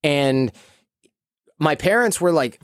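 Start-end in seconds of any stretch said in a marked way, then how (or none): none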